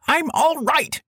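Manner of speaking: a growl that cracks high into a squeak; squeaky growl. A narrator's reading. Dazed president brushing off his doctor, growl turned squeak. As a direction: try to growl but squeak instead. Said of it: "The growl came out as a squeak".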